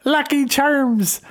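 high, drawn-out cartoon Irish; mock Irish accent. Comic sending up his own failed impression, mock Irish accent. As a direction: ham up a terrible Irish accent. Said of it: "I'm not good with accent".

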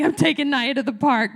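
loud, high, emphatic announcement; husband announcing the park trip, loud and emphatic; husband's declaration. Retold or imitated. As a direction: declare it over the laughter. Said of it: "Chris is like".

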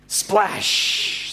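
voiceless splash with a long sh; sound-effect splash. Acted. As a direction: burst the word like water hitting. Imitating Jonah hitting water.

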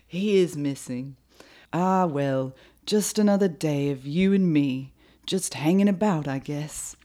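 loud, put-on British lad; Oliver Twist British. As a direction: act a musician pondering the song in mock British. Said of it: "he's gonna sound kinda like Oliver Twist".

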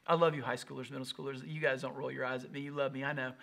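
ordinary delivery

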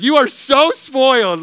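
loud, high teasing cry of the crowd; playful loud jab; mock accusing shout. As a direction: throw it back at him like a jealous audience. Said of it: "you're all like".

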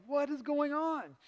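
slow high what is going on; indignant older brother puzzling at the noise; startled question. Retold or imitated. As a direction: act out the stunned brother arriving home. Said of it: "He's like".

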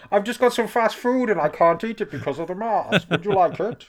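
squeaky pervy Yoda asking for a lift. Pretend. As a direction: play a cheeky Yoda calling his friend. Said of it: "pervy part of pervy Yoda".

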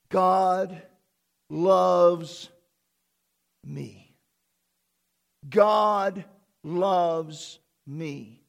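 each word set apart, slow and weighty; slow emphatic proclamation. Shown as a demonstration. Pastor spelling out the revelation to church, slow and weighty. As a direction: land every word of the truth slowly. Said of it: "slow emphatic proclamation".

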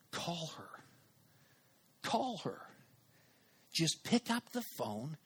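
soft, measured inner prompt; quiet inner nudge. Retold or imitated. The thought that wouldn't go away, softly insistent. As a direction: nudge gently but insistently, leaving space. Nagging inner voice in his head.

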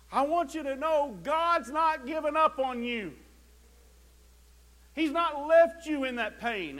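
loud, high revival preaching. Acted. Preacher urging the hurting congregation, loud preaching.

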